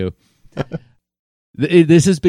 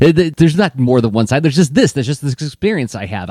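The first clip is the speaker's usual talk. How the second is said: louder, higher mock insistence; indignant kid's protest; 4 semitones higher, 6 dB louder than usual. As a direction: argue back like a stubborn kid. Retelling a child's stubborn protest.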